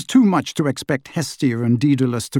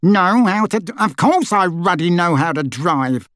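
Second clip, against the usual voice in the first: louder indignant sputter; spluttering; 6 semitones higher, 3 dB louder than usual. A narrator's reading. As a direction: splutter in offended outrage. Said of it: "spluttered Uncle Vernon".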